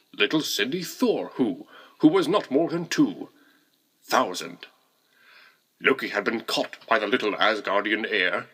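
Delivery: plain reading voice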